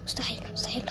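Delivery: breathy whispered disbelief, then a pushed urgent whisper; panicked whisper for mama; desperate whisper. Acted.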